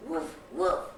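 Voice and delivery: gruff, loud, voiceless woofs; two short husky dog barks. A narrator's reading. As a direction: bark twice like a dog. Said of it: "barked the dog".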